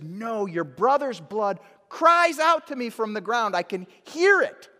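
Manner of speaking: loud insistent God. Acted. God answering that the blood cries out, loud and insistent. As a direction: cry it out as God who hears. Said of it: "He says".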